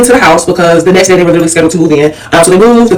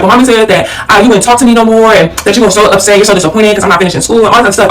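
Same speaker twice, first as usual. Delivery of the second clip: ordinary delivery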